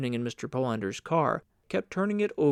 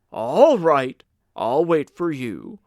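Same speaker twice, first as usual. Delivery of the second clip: louder call across the room, easing off; shouting after someone; 9 dB louder, slower than usual. Acted. Polander calls after Carl as he hurries off, shouting. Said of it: "he shouted to Carl".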